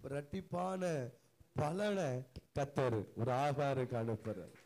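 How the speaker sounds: loud, slowed proclamation in measured phrases; forceful preaching. Real, not acted. Preacher proclaiming double blessing to the shamed and outcast, forceful preaching.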